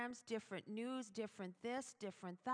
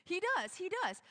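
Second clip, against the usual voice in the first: much higher and louder, insisting; preacher driving home that God keeps her close; emphatic preaching; 10 semitones higher, 11 dB louder than usual. Real, not acted. Preacher insisting to the ladies, high and loud. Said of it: "I'll tell you this".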